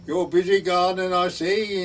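put-on high, loud Welsh-accented parson; Evans. Welsh parson to a man gardening, Welsh accent. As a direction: imitate a Welsh parson calling over the wall. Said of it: "in his Welsh voice".

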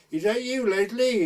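put-on woman at her window calling down to the drunk lad. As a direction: call down sharply to the street.

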